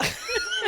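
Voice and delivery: high squeal of laughter; burst of laughter. Real, not acted. Host amused by the slow joke, giggling high. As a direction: crack up at your own line.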